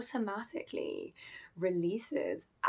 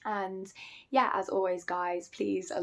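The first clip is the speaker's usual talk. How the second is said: usual speaking voice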